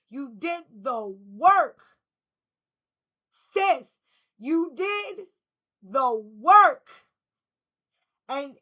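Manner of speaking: loud, slow, punching each word; emphatic praise. Real, not acted. To the viewer, praising her hard work, loud emphatic praise. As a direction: hammer home how much work she did.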